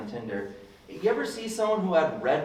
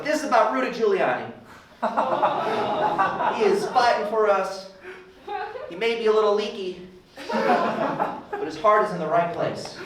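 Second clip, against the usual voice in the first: mock conservative fan; 6 semitones higher than usual. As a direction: gush about Giuliani through laughter. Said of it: "It's like".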